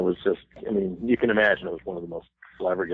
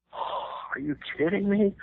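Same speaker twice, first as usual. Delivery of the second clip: much higher, voice leaping up in mock disbelief; inner disbelief; 13 semitones higher than usual. Starstruck musicians reacting to [name] singing, in high disbelief. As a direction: squeal it like a starstruck fan. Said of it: "inside we're going".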